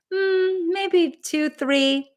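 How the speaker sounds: high, hesitant little answer; imagined participant. Acted. Voicing participants' chat answers.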